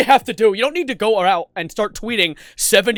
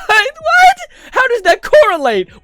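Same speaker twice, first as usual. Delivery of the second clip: much higher, louder what; incredulous yelp then louder question; shocked disbelief; 9 dB louder, slower than usual. Real, not acted. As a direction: shriek it in disbelief. Disbelief at tweet.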